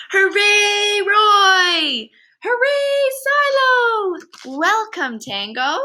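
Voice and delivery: loud, high, happy cheers; cheering. A narrator's reading. Zoo children cheering the penguin family, loud and high. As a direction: cheer them on like a crowd of kids. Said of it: "they cheered".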